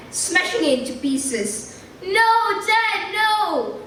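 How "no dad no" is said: high, loud desperate cry; boy shouting to his crashing father; anguished shout. Acted. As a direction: yell out to stop his dad. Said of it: "shouted Frank".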